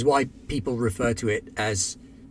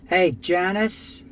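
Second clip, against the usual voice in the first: calling out; 7 semitones higher, 4 dB louder, much slower than usual. Acted. Calling out to a coworker.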